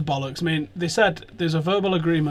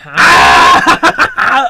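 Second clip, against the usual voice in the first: loud laughing cry, as if the whole room cracked up; loud laughing shout; 13 dB louder than usual. Retold or imitated. Imitating the players' loud laughing outburst when he walked in. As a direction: burst out with a big laughing "Ah!". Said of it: "They went".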